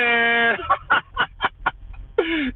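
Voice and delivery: soft high laugh; amused laugh fading out. Real, not acted. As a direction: chuckle at the joke. Laughing at the Dora meme.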